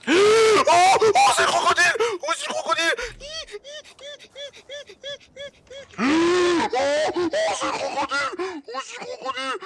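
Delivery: high panicked shrieks; scared horror game streamer. Real, not acted. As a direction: scream in terror at the croc. Crocodile attack, screaming.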